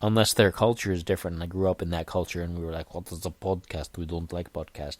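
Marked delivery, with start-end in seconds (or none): 2.9-5.0 low, quiet, badly faked Greek accent; terrible Greek accent. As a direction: do a poor mock-Greek accent, pitched down. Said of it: "That's not a Greek accent at all".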